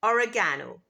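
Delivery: oregano stressed on the third syllable, or-e-GAH-no; British oregano. Shown as a demonstration. Teacher models British oregano. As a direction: lean on the GA in oregano. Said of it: "In British English, it's".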